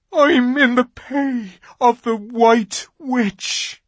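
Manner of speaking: slow, high, loud anguished fawn; anguished confession. A narrator's reading. Fawn confessing to Lucy, slow and anguished. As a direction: force the confession out.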